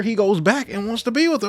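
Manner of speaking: normal talking voice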